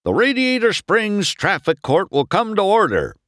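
high, projected official announcement; sheriff calling out. A narrator's reading. Sheriff opening traffic court before the townsfolk, calling out. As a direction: call the court to order. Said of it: "the sheriff called".

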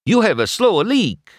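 put-on high, loud Italian-accented shopkeeper; chirpy Italian tire man diagnosing; Italian-accented little car; Luigi, Italian accent.

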